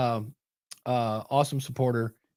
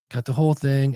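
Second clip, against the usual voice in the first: usual speaking voice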